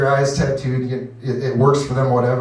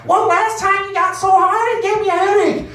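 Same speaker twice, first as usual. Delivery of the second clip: hammed-up old wife; complaining old wife. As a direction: grumble at him like a granny. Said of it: "use different voices".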